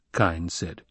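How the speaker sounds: plain reading voice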